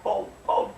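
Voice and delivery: loud spoken bang bang; gunshot sound. Acted. Hunter shoots the wolf, loud bangs. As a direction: fire the shots with your voice.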